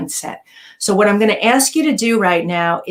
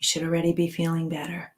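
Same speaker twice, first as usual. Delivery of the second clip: guided meditation; 4 dB quieter than usual. Acted. Guides breathing and relaxation.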